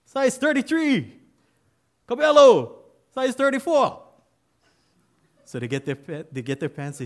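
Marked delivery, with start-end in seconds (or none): 0.0-1.2 very high, loud recruit answer; a recruit shouting his size back; shouted reply. Acted. 2.1-2.8 very high, very loud name call; sergeant shouting. Retold or imitated. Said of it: "Clothing sergeant calls out". 3.1-4.0 very high, loud recruit answer; a recruit shouting his size back; shouted reply. Acted.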